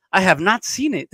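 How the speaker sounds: ordinary talk, nothing marked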